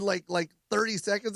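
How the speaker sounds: usual speaking voice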